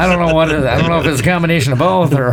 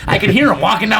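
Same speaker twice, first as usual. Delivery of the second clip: ordinary talk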